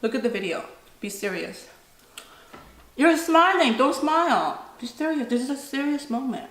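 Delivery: half-hearted stern mom; mock serious. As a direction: play at being strict to make the kid giggle. Mock-stern mom teasing child.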